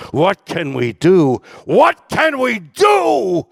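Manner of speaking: swelling from a loud plea to a high shouted cry; building lyric cry. Retold or imitated. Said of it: "are the lyrics, "What can we do?".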